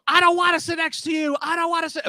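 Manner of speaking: very high, loud shout; screaming lady. Retold or imitated. Lady shouting at the man she won't sit by, very high. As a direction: shriek it like a tantrum. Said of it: "it was like".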